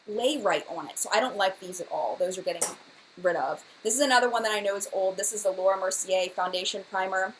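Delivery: ordinary talk, nothing marked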